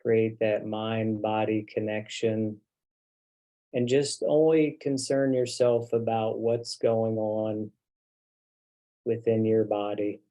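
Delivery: unhurried, settling meditation voice with long pauses; guided meditation. Real, not acted. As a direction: guide them gently into the body, leaving long silences. Leads the group into meditation.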